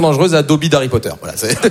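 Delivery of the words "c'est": usual speaking voice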